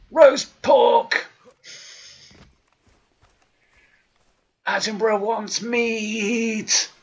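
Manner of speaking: loud, high, slow hungry roar; excited shout. Acted. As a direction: bellow it with greedy glee. Hungry hunter outburst.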